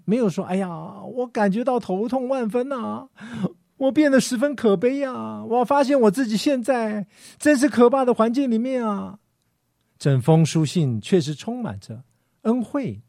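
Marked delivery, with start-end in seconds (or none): 0.5-9.2 higher, whiny moaning sigh of self-pity; mock whining complaint. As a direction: moan like a sorry martyr, a little hammed-up. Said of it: "保罗在这里没有抱怨，没有说".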